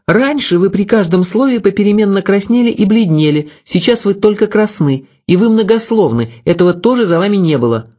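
high-pitched, needling rebuke; snappish woman. A narrator's reading. Mary tells the scolding man he is not himself, snappish. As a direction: keep snapping back, pitched well above the narration. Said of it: "огрызнулась она".

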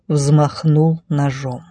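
plain reading voice

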